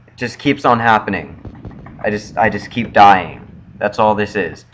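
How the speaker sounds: ordinary talk, nothing marked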